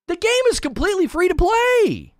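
very high-pitched, incredulous protest; exasperated insistence. Real, not acted. Streamer defends monetization to chat, exasperated. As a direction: push the voice right up in disbelief.

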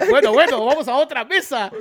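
mock host escaping an awkward table; flustered host. Host abandons the quarrelling couple for another table, flustered host.

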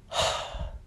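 soft unvoiced exhale; breathy sigh. Real, not acted. Narrator searching for words about his breathing, soft sigh. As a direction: let out an audible breath.

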